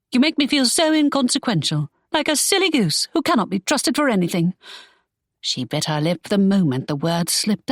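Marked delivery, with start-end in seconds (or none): 0.1-4.5 put-on higher, hurt young woman; wounded complaint; Imogen hurt. Imogen telling Draco how he makes her feel, hurt.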